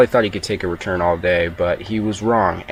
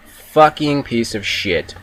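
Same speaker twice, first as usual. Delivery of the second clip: slow drawn curse; 2 semitones higher, much slower than usual. Retold or imitated. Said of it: "and I'm like".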